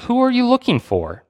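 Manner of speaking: a high, pressing question; mock demanding challenge. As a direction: demand an answer. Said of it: "he's demanding".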